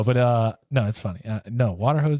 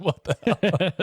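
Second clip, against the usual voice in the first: incredulous what the hell; 6 semitones higher, much slower than usual. Retold or imitated. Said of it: "You're like".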